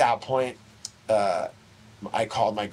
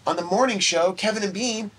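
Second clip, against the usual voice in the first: put-on excited girlfriend; 6 semitones higher than usual. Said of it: "She's like".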